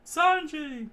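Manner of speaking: high, loud shouted name; scream. A narrator's reading. Chef screaming from behind, high and loud. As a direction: yell his name across the kitchen. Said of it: "one of the chefs screamed out".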